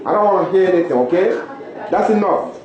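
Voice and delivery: loud, firm, no arguing; stern warning. Acted. Father orders the kids to stop fighting, stern and loud. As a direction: shut the quarrel down.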